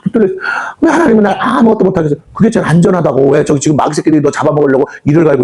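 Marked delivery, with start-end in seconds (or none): none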